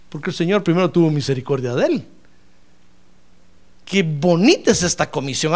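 ordinary delivery

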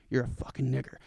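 low, soft, private mutter; demonstrating a discreet low-voiced insult; under the breath. Shown as a demonstration. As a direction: keep it down so nobody else hears. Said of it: "'Cause if you're gonna say it in public, you should just be like".

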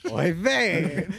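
high, loud Yiddish oy vey; Yiddish accent. Retold or imitated.

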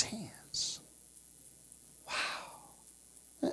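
slow tender whisper. Real, not acted. Preacher whispering to the congregation, tender whisper. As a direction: breathe it like a secret.

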